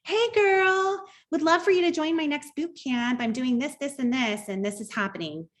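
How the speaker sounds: put-on high, sing-song "Hey girl" pitch; mock spam message. Mocking the copy-paste recruiter's messages to the mom, in a high sing-song voice. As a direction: parody a pushy canned sales message, voice pitched high. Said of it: "It was the".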